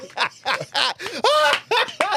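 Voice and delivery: high shrieking laugh; loud laughing. Real, not acted. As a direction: burst out in a loud cackle. Laughing at the joke.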